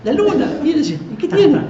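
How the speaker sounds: very high-pitched mock bystanders; acted puzzled exchange. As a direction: act out baffled passers-by, high and comic. Acted exchange between crowd and passers-by.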